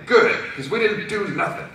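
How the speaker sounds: high, defensive Brooklyn worker; Brooklyn accent. Acted. Concessionaire denying any part, defensive Brooklyn accent. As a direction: snap back in a put-on Brooklyn accent. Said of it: "talk like they're from Brooklyn".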